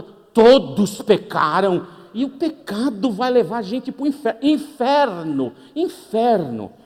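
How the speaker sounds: loud, slow hellfire preaching; mock fire-and-brimstone preacher. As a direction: thunder the word inferno. Preacher booming about hell.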